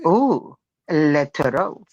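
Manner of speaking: halting, low mock-French accent. [name]'s turn in accent game, halting French accent. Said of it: "with the French accent".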